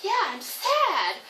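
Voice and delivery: high, loud, drawn-out imitation of a little kid; child naming feeling. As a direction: stretch it out like an emphatic small child. Child proudly adopts the named feeling.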